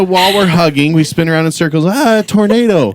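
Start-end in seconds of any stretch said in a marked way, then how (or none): 1.9-2.9 high mock cry of alarm; pitched-up, hammed-up disaster call; playful acted cry. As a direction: cry out as if playing a silly game. Acts out the spinning game.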